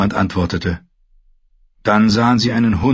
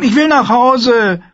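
put-on high, slow, insistent child voice; a small boy demanding to go home; 6 dB louder, slower than usual. A narrator's reading. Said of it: "verlangte Rickon".

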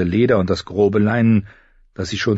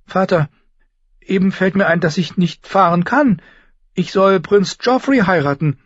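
put-on high, raised girl's appeal; protesting girl; 12 semitones higher, 5 dB louder than usual. Sansa tells father she must marry, protesting.

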